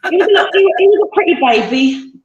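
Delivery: high, loud fond gush; laughing fondly. Real, not acted. Proud mother gushing, laughing.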